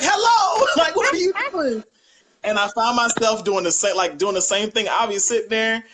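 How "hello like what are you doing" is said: very high, loud indignant hello; mock outraged dad. Dad to the son who barged in, very high and indignant. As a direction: act scandalized, half-laughing. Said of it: "He's like".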